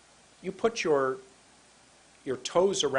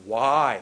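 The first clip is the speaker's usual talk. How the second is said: WHY pushed out long and loud; drawn-out word; 3 semitones lower, 8 dB louder than usual. Shown as a demonstration. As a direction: stretch WHY with strong intonation. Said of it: "Made that word so long".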